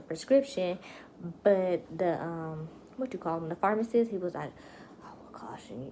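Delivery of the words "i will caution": hushed warning whisper. Retold or imitated. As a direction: drop to a secretive whisper. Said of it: "He was saying it like that".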